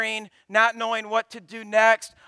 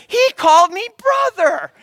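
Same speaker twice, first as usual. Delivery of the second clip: put-on squealing kid's voice, much higher and louder; excited child; 12 semitones higher, 12 dB louder than usual. Boy telling his siblings, high gleeful kid voice. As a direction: imitate a delighted little boy telling everyone. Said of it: "telling Libby and his other brothers".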